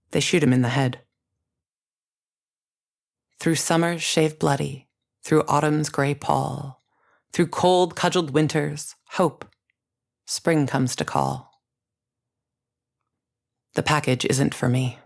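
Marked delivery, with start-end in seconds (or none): 3.4-11.6 slow, measured poem reading; recited poem. A narrator's reading. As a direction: let the verse fall slowly.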